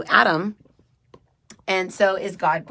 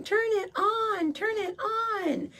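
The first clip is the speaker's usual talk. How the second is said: put-on high, slow, wailing little kid; crying toddler; 4 dB quieter, slower than usual. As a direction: whine it like a sobbing child. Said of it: "I was screaming and crying".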